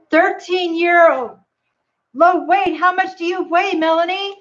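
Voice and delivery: high gasping excitement, slowed; excited gasp. Real, not acted. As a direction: gasp with delight at the news. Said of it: "I'm super excited for you".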